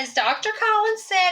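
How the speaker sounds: usual speaking voice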